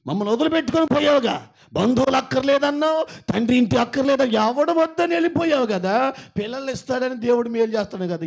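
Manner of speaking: loud, high accusing relatives; scornful reproach. Acted. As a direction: hurl the blame at him, fast at first. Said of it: "అబ్రహాముని ఇలాగనే అడిగారు".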